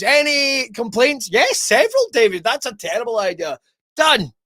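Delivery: high, mock-formal call for objections, then brisk dismissal; hammed-up chairman brushing off objections; pompous seventies architect. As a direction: act out a farcical board meeting. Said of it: "some fucking architect in the seventies went".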